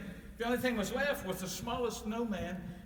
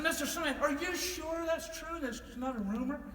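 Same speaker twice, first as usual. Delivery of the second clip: eager question; 10 semitones higher than usual. Acted. Santa asking old snowman, high and eager. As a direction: ask the snowman in wide-eyed disbelief.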